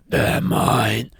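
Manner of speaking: rough, drawn-out villain voice; menacing Antichrist. Acted. Antichrist claiming souls from Jesus, menacing villain voice. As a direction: claim them like a dark lord. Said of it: "it'd be more like".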